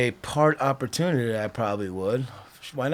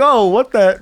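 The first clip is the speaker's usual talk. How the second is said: higher, louder startled cry; surprised outburst; 7 semitones higher, 5 dB louder than usual. Retold or imitated. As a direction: caught off guard mid-run. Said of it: "I was like".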